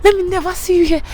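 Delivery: high, firm banishing voice; hammed-up threat never to return; mock stern warning. Wife walks her out, high mock stern warning. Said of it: "she's like".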